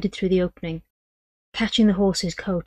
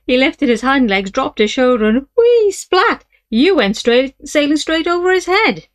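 higher, louder and mocking; gleeful retelling; 6 semitones higher, 7 dB louder than usual. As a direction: relive the fall with glee. Partner mocking the fall, laughing.

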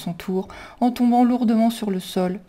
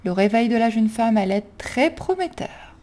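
plain reading voice

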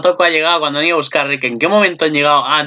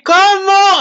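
high, loud disbelieving cry; shocked how; 5 dB louder than usual. Retold or imitated. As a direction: demand it in shock. (grito agudo y fuerte de asombro)

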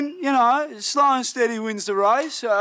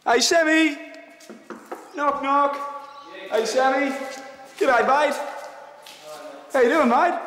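higher, cheery call at the door; friendly calling out; 4 semitones higher than usual. Acted. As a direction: hail the neighbour warmly.

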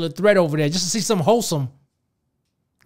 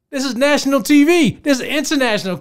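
higher, louder repeat of national T V; outraged emphasis; 6 semitones higher, 4 dB louder than usual. Real, not acted. Vlogger stressing the show aired on national TV, loud outraged emphasis. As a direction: hammer the point home in disbelief.

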